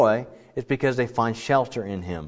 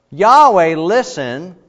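a loud, higher plea to Yahweh; lament cry; 5 semitones higher, 8 dB louder, slower than usual. Shown as a demonstration. Psalmist begging God to listen, loud cry. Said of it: "start out with the word".

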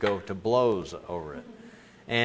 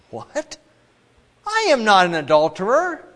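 high, loud offended outburst; indignant protest; 9 semitones higher, 7 dB louder than usual. Acted. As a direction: bristle at the accusation. Listener protests the accusation.

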